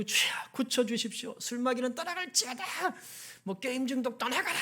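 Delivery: imitating a high, fervent prayer-meeting cry; mock fervent prayer. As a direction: ham up a believer rebuking demons in prayer. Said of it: "막 하면서 막 기도하고".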